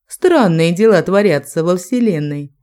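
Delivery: ordinary delivery, nothing marked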